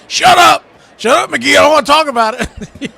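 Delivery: high, loud mock-angry shout; annoyed shout. Yankee fan snapping at teasing coworker, high angry shout. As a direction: bark it back at the teasing coworker. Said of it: "And he'd go".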